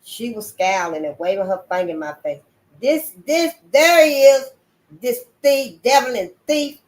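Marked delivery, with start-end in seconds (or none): 2.8-6.8 high, loud outraged accusation; furious denunciation. Acted. Missus Bill denouncing the thief to her husband, high and loud. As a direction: point and denounce him.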